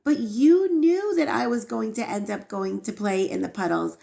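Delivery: high, indignant protest to a father; imitating an outraged kid; retold child's yelling. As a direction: blame your father, pitch rising. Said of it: "yells at his father".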